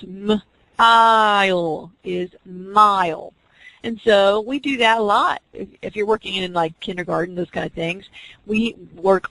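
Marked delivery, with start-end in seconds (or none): 0.0-3.3 very slow, "m-ile" split then joined as "mile"; "m-ile" stretched, then "mile" said whole; blending sounds. Shown as a demonstration. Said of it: "The students".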